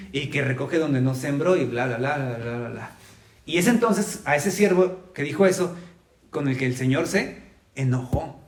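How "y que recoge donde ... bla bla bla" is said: fading into quiet dismissive bla bla. Real, not acted. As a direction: let it trail off, brushing it aside. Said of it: "dismissive trailing list".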